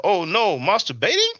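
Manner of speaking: high, loud oh no; shocked gasp of a question; mock shock. Reading a viewer's shocked comment aloud, high and loud. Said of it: "Enki said it".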